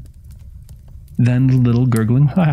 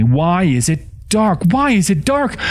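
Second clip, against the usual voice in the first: high, rising panic, stretched out; frantic high-pitched plea, climbing on the repeat; panicked inner scream, acted without real shouting; screaming thought; 9 semitones higher than usual. A narrator's reading. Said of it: "then thought screamingly".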